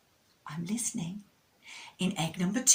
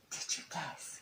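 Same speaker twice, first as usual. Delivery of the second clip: hushed; 2 semitones lower, 10 dB quieter than usual. Acted. Suspense before revealing empty egg.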